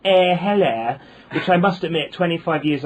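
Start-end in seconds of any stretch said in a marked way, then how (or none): 0.0-1.0 mock Essex greeting. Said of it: "she'll say".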